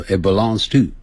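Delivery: it belongs to run together quickly; fast linked. Shown as a demonstration. Teacher showing the fast native way to say it. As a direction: rattle off it belongs to in one breath. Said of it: "但念快的时候".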